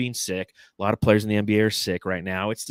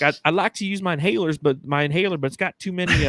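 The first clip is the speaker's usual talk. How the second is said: lightly voiced cashier, pitched up; rambling higher about her inhalers; sick cashier; 7 semitones higher than usual. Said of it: "she's like".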